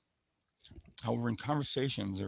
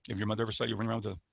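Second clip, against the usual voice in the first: ordinary talk, nothing marked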